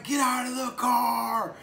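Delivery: high, loud, slowed mock cop yell; police shouting. Retelling how police ordered people out of the car, loud yelling. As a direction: bark it like a cop ordering a driver out. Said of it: "they're yelling and screaming".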